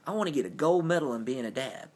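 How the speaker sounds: ordinary talk, nothing marked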